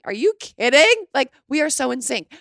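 high, loud joyful disbelief; excited disbelief. Real, not acted. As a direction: burst out, thrilled. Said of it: "excited disbelief".

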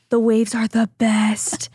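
slow imitated boyfriend voice; imitating boyfriend. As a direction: do his voice, slow and deadpan. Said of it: "how I imitate his voice".

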